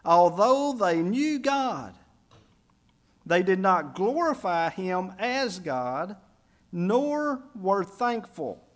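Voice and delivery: slow weighty emphasis, pitch up; underlined scripture. Shown as a demonstration. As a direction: hammer each phrase slowly. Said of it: "That's the part to underline".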